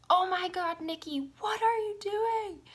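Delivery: high, loud frantic mother; mother bursting in, high and loud in panic; panicked mom. Retold or imitated. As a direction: cry it out like a terrified mom. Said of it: "I just remember her voice".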